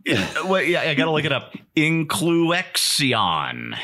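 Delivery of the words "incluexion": "Incluexion" drawn out very slowly, higher; exaggerated X. Shown as a demonstration. Host looking up the monstrosity of a word, "Incluexion" very drawn out. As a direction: stretch "Incluexion" syllable by syllable, hitting the "ex". Reads out the mocked made-up word.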